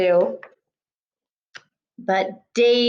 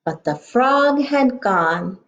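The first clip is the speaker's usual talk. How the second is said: ordinary talk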